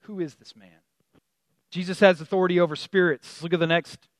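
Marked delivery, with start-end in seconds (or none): 0.0-0.8 hushed, low mock dread; terrified, retold. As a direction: quietly voice the frightened disciples. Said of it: "they were terrified".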